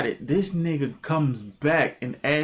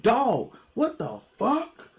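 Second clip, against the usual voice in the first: stunned outrage; 9 semitones higher, slower than usual. Retold or imitated. Reacting to stolen four hundred dollars.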